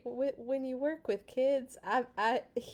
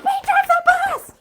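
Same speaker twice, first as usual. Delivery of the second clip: very high, loud screamed demand; tantrum scream; 17 dB louder than usual. Acted. Pigeon screaming at the reader in a tantrum. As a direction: throw a full tantrum.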